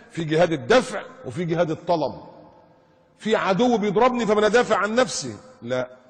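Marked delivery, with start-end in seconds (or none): 3.2-5.3 loud, high insistent argument; heated protest. Real, not acted. Preacher argues self-defence to accusers, heated. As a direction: raise the voice and pitch to protest.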